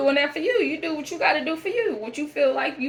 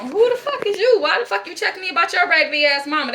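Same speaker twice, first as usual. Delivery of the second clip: higher, louder outraged challenge, hitting "you"; angry confrontation; 6 semitones higher, 3 dB louder than usual. Retold or imitated. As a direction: demand who she thinks she is, loud and high. Confronting the daughter, mock address.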